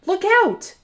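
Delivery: high urgent warning call; shouted warning, high and loud. Acted. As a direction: yell it out to warn her. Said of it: "shouts Gecko".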